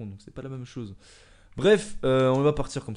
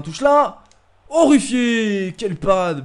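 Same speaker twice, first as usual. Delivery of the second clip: elated shouting; 9 semitones higher, 14 dB louder, much slower than usual. Real, not acted. Celebrating the final whistle.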